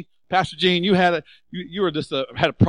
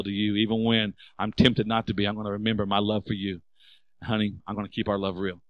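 tender vow; 5 semitones lower than usual. Acted. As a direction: promise her gently, like a loving husband. Husband's loving vow to wife.

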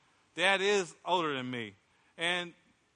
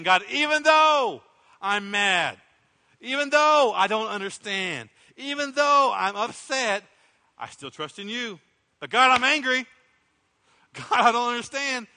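slow, louder, higher cry of frustration to God; angry prayer to God; 6 semitones higher, 8 dB louder than usual. Pretend. Preacher voicing a believer praying, loud pleading anger. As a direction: pour out your anger to God, still trusting.

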